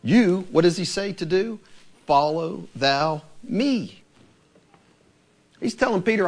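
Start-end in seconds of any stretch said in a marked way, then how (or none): 2.1-4.0 drawn out, each word weighed; slow, grave words of Jesus; slow solemn command. Acted.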